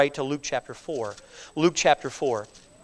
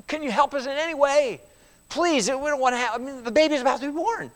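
higher, hurried begging for help; urgent pleading; 7 semitones higher than usual. Retold or imitated. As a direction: plead as the desperate husband.

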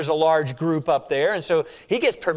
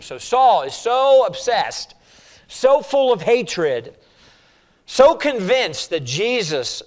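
slow, weighty, higher and louder build; slow intense emphasis; 4 semitones higher, 4 dB louder, slower than usual. Acted. Preacher stressing Saul's obsession, slow and heavy. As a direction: drag out each phrase with rising zeal.

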